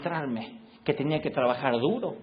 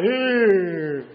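put-on loud, lazy teenage grunt; 5 semitones higher, 8 dB louder than usual. Teen son grunting at his arguing parents.

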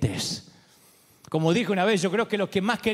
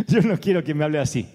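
laughing; 5 semitones lower, faster than usual. Real, not acted. Laughing at his own joke.

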